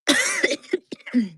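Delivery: harsh dusty coughing fit; coughing on dust. Real, not acted. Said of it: "This dust is getting me".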